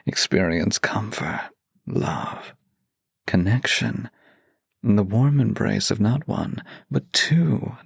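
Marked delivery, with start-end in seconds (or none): none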